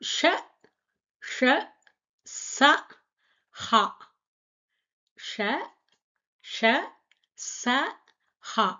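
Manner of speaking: ஷ ஷ ச ஹா said apart, the ஷ hushed; Hindi sha-row recited. Shown as a demonstration. Teacher to students, reciting the sibilant row. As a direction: say ஷ ஷ ச ஹா slowly. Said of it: "அடுத்தது".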